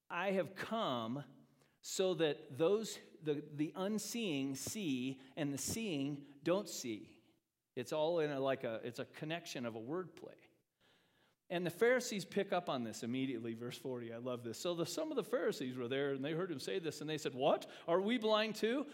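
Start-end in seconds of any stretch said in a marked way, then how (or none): 0.1-7.1 each word laid down slowly; slow deliberate paraphrase. Acted. Preacher slowly paraphrasing Jesus to his congregation, weighing each word. 17.3-18.9 higher, louder offended question; indignant Pharisees. Acted. Pharisees overhearing Jesus, loudly offended and surprised.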